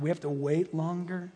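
ordinary delivery

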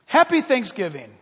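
lightly voiced, loud holiday greeting; hearty retold hello; imitated friendly gentleman. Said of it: "And he said to us".

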